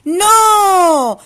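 high, loud little pig refusing; little pig's no. Acted. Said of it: "dijo Tin".

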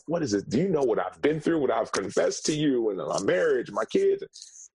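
acting out an exasperated, pleading question; incredulous protest. Man protesting to his friend after confessing his troubles, incredulous.

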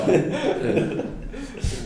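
high laugh. Real, not acted. Friends laughing at joke.

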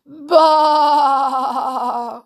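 loud, high, long sheep baa; mournful bleat. A narrator's reading. Echoing bleats all round the dungeons, mournful baa. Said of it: "went the mournful bleats all round".

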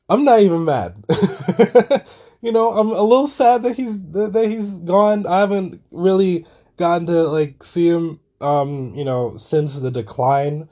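high and loud, laughing even about being sad. Real, not acted. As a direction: giggle through the sad part. Laughing through mild sadness.

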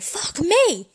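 high, loud yelp of surprise; shocked outburst. Retold or imitated. Reading a dirty text, a loud high surprised yelp. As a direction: blurt it out, thrilled and shocked. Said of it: "And you're like".

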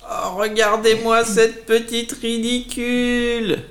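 high, slow sneering spider voice; mocking spider. Big spider sneering at the little caterpillar, high and slow. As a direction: drag out the insult like a snide big spider. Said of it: "dit la grosse araignée".

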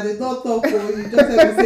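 giggling denial; loud laughing protest. Real, not acted.

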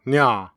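"nya" as one open syllable; Seoul question ending. Shown as a demonstration. Narrator showing the Seoul question ending, nya. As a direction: end on a single open "nya". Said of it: "often end interrogative sentences with".

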